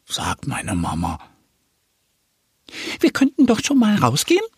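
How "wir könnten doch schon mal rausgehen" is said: much higher, quick duckling voice; eager duck. Acted. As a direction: rush the suggestion like an impatient duckling. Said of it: "schlägt Annie vor".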